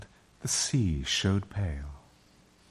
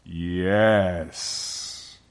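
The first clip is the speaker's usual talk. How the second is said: long, resonant lion's answer; grand, solemn proclamation; great voice. A narrator's reading. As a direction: draw the word out majestically. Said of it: "said a great voice".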